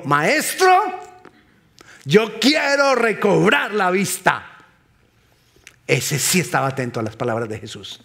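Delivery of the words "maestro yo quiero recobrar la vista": high, slow declaimed plea; ringing, drawn-out acted request; emphatic plea. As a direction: plead it out, stretching the words. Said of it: "El ciego le dijo".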